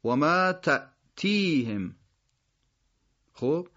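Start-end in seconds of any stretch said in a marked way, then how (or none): 0.0-2.0 تأتیهم slowly, glottal stop after "ta"; still hamza. Shown as a demonstration. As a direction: stop on the hamza in تأتیهم, then stretch "tii". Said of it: "همزهٔ ساکن هست". (و ما تأتیهم شمرده، با همزهٔ ساکن)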